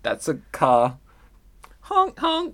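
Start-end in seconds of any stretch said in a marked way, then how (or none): 1.8-2.5 car horn honk. Pretend. Pretending to be a car.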